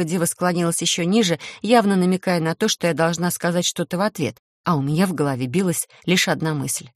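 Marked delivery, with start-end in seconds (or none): none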